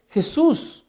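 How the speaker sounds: high, loud, slow pleading; grieving sister crying out to Jesus, pleading; tearful plea. Retold or imitated. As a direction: beg him through tears, drawn out. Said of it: "Lloraba, lloraba".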